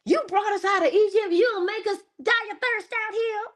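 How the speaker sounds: high-pitched, loud mock complaint; complaining crowd. People of Israel in the desert berating Moses, high acted complaint. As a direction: act out an aggrieved people wailing at their leader.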